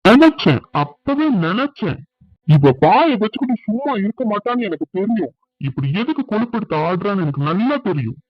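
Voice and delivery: high, furious accusation; angry outburst. Acted. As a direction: erupt in anger at your wife. Husband erupts at wife in quarrel.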